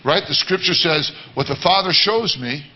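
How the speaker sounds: ordinary talk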